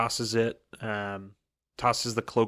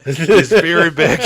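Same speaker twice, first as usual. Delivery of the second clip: loud, higher, breaking into laughter; laughing; 6 semitones higher, 11 dB louder, slower than usual. Real, not acted. Host cracks up at own veiny description, real laughing. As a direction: crack up through the words.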